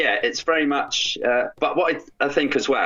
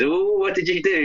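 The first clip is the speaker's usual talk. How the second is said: high, mock-posh British accent; lightly voiced British shame wizard; 9 semitones higher than usual. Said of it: "he's got a great British accent".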